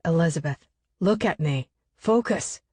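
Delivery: ordinary delivery, nothing marked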